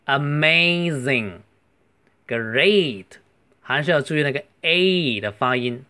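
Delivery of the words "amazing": amazing with a long drawn A. Shown as a demonstration. Teacher shows students the long A in amazing. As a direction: stretch the A in amazing. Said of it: "注意那个A是长音".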